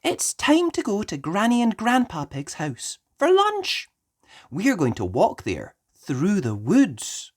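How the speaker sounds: a kind mum announcing the plan; Mummy Pig voice. Acted.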